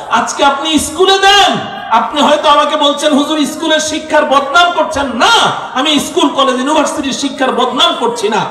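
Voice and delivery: rising to a furious shout against the ruler; fiery preaching. Acted. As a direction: thunder at the congregation. Preaching at full force.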